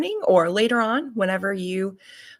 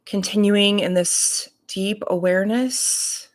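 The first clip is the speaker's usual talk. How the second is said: unhurried meditation voice, long pauses between phrases; guided meditation. Real, not acted. Reverend leading morning listeners into breath and body awareness, guided meditation. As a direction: guide them calmly, leaving space to breathe.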